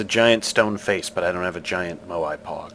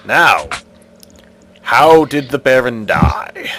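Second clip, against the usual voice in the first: commanding stone guardian, louder; stone face voice; 3 semitones higher, 11 dB louder than usual. Acted. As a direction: demand an answer like an ancient idol.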